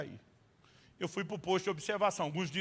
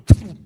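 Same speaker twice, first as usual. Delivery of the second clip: sudden loud pum sound effect; 20 dB louder than usual. Retold or imitated. Preacher booming a teleport sound. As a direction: bang it out like a teleport.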